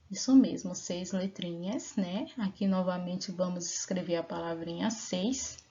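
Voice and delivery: normal talking voice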